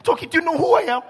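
high, loud offended protest; hammed-up outrage at being disrespected; mock indignation. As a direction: act affronted and self-important. Mocks offended self-important church people.

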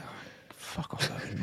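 under-the-breath whisper; whispered aside. Retold or imitated. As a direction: whisper it to yourself, annoyed. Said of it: "the thought of".

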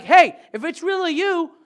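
high, loud eager call; loud excited Peter calling out. Acted. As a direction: call out boldly across the water. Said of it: "And Peter's just like".

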